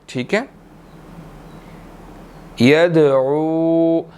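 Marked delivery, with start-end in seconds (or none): 2.6-4.0 slow "यदउ" with a bouncing d; "यदउ" with an echoing d; qalqala on dal. Shown as a demonstration. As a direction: echo the d of "यदउ". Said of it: "दाल पर कलकला होगा".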